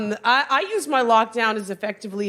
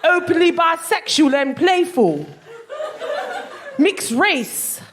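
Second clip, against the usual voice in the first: put-on London accent, pitched up; guessed London accent; 7 semitones higher than usual. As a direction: read it out proudly in a broad London accent. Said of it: "guess what his accent is".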